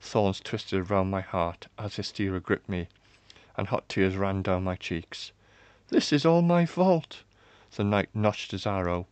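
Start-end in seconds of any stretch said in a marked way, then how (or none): 5.9-7.2 high, slow, loud lament; anguished. A narrator's reading. Daughter blames herself before the execution, anguished and high. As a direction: cry out in guilt.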